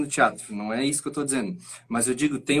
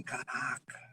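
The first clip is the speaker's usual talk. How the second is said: hushed, breathy imitation of amazement; barely voiced mock astonishment; awed reaction; 2 semitones lower, 15 dB quieter, slower than usual. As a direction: whisper it like a stunned onlooker. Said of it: "a galera fica assim".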